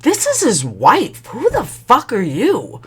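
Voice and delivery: high, slow, swooping outrage; indignant comeback. Retold or imitated. Wife confronting the other woman by phone, indignant. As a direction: stretch each word with high-pitched attitude.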